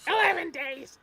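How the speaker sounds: very high kobold shriek; squeaky yappy lizard chatter. Acted. Echoing the kobold bit.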